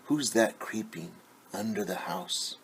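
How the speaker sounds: plain reading voice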